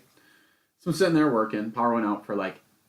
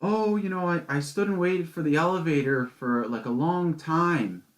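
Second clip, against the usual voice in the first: higher-pitched imitation of a rambling woman; woman guest retold; 5 semitones higher than usual. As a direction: retell her complaint in a raised voice. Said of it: "some woman comes down and she's like".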